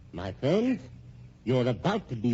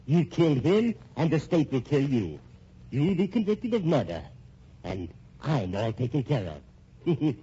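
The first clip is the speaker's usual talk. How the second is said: ordinary talk, nothing marked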